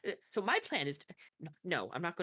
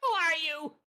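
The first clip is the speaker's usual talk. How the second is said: high, loud chicken voice; scared chicken; 11 semitones higher, 5 dB louder than usual. Acted. Chicken looks back at its keeper, high frightened squawk. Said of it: "the chicken looks back at her and goes".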